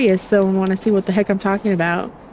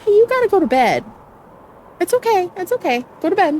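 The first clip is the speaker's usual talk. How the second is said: much higher, sing-song coaxing voice; coaxing to bed; 9 semitones higher, much faster than usual. Real, not acted. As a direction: gently talk a little one into bed. Talking to a pet or child.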